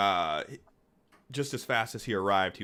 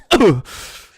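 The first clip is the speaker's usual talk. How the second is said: loud burst of laughter; 8 semitones higher, 12 dB louder than usual. Real, not acted.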